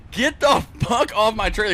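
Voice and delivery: loud barked order; angry yell. Retold or imitated. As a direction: yell him off the trailer. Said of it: "And I said".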